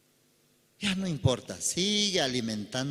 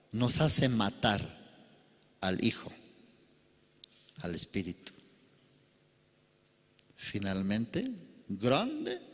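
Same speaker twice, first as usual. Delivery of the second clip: usual speaking voice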